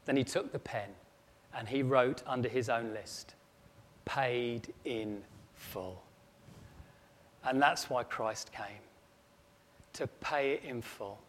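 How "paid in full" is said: slow, soft, deliberate; hushed and moved. Acted. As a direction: let it settle like a confession. Son writes under his list.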